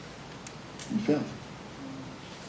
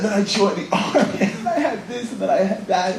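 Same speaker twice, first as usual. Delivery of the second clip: laughing reminiscence; 10 semitones higher than usual. Retold or imitated.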